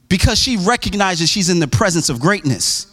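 ordinary talk, nothing marked

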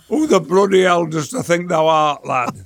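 broad Yorkshire jeer, punched at the start; mock him in thick Northern England dialect. Friends mocking his new pronunciation, broad Yorkshire jeer. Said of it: "I would be cruelly mocked".

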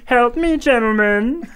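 high-pitched, hammed-up cry for help; old French woman. [name] calls his owls, old French woman voice. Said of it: "He talks like a French woman".

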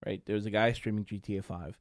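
normal talking voice

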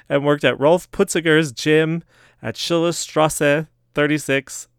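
mock German accent, slowed; hammed-up German reading of street names; playful put-on German accent. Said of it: "keep this German accent going".

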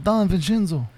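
Don Vincenzo said slowly in Italian style, Vincenzo with a ch sound; Italian pronunciation. Shown as a demonstration. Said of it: "You gotta say it".

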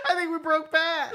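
laughing through the words. Real, not acted. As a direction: giggle it out. Laughing while speaking.

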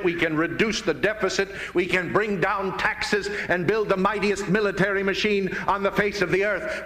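ordinary talk

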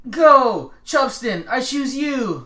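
earnest, determined anime hero shout. Pretend. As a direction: call out your Pokemon like Ash. Pokemon trainer intro bit.

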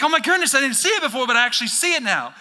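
high, loud delighted gasp of discovery; sudden aha, thrilled; acting out the moment it clicks; excited realization.

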